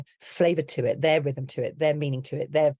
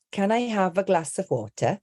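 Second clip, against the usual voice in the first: higher, crisp British delivery with a true t in water; British accent; 5 semitones higher than usual. Shown as a demonstration. Accent coach contrasting the same sentence by accent, British accent. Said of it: "the sentence does change depending".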